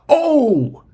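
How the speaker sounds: high, loud mock yelp; imitated player's yelp. Host imitating Shai driving to the basket, exaggerated yelp. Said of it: "he makes a noise".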